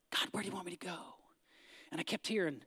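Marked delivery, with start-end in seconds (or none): 0.1-1.0 soft unvoiced whisper to God; whispered prayer. Preacher whispering his prayer to God, hushed. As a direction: breathe the question out in a hush. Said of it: "that still small voice".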